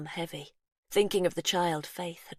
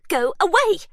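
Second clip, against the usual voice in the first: high, loud yell; 14 semitones higher, 11 dB louder than usual. Acted. Faith yells at the intruders to go away. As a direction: drive them off with a shout. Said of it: "Faith yells".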